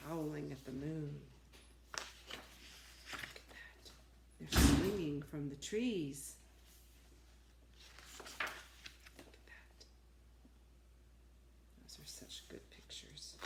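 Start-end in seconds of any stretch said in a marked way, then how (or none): 1.9-3.9 hushed whisper over the picture. Real, not acted. Showing the pictures to children, whisper. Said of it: "whispered aside over pictures". 8.4-13.3 whisper. Real, not acted. Pointing at the pictures for kids, whisper. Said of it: "whispered aside over pictures".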